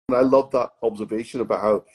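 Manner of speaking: normal talking voice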